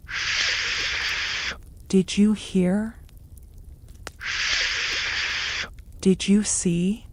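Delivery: breathy hushed servant gossip; gossiping whisper. Acted. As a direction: whisper it like a secret rumor. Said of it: "The sound of these whispers".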